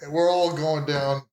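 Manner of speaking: ordinary talk, nothing marked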